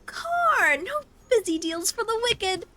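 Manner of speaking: goblin voice. Acted. Goblin character line.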